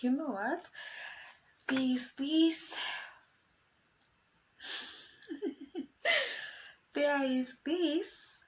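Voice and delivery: high, loud, slow and bubbling over with giggles; can barely get the news out for laughing; excited news. Retold or imitated. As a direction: niece bursting with excitement, giggling. Niece bursting in with news.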